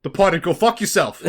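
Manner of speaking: put-on Boston accent. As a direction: curse like a Boston movie tough in a mock accent. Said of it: "Boston accent".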